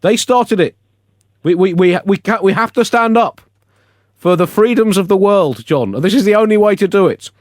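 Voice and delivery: rally for freedom, hammed up; indignant protest. Real, not acted. Host defends his puns to John, high indignant protest.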